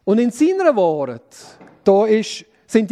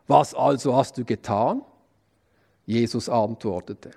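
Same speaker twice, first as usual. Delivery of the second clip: measured, lower reading voice for the Passion text; scripture reading; 7 semitones lower than usual. Acted. Preacher reading the trial before Pilate to the congregation, solemn scripture reading. Reads the Passion account aloud.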